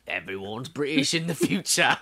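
put-on British accent; hammed-up old-timer's British accent; old British man. Co-host retrying his accent as an old man of the future, British accent. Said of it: "That was better".

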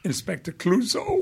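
a laughing hint of Inspector Clouseau's French accent; mock Clouseau accent. Recalling the failed French Picard, mock Clouseau French accent. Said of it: "sounded like Peter Sellers".

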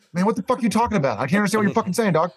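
high, fast, baffled friend; incredulous fast. Retold or imitated. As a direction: rattle it off, baffled. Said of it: "him just being like".

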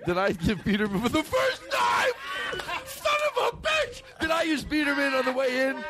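high-pitched outburst at his own slip; worked-up, high exasperated yelling; exasperated shout. Real, not acted. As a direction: yell in comic frustration, voice leaping way up. Realizes he botched the bit.

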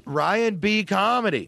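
high, slow calling-out voice; fallen-in-a-well voice. Pretend. Answering the villain from down a well, high drawn-out call. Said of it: "I've fallen in a well voice".

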